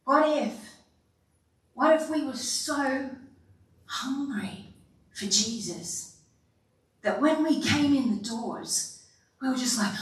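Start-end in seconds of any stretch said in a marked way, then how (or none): none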